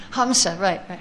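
ordinary delivery

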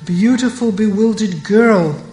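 angry. Acted. Purnamasi scolding Radha, mildly angry. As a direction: scold the girl with mild heat. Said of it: "Purnamasi angrily looks at Radha and says".

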